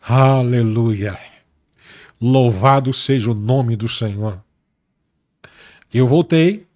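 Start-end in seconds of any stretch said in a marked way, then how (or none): 0.0-4.4 slow, low hallelujah of worship; reverent praise. Real, not acted. Preacher praising the Lord mid-testimony, low and reverent. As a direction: praise God slowly, in a lowered voice.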